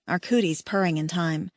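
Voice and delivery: ordinary delivery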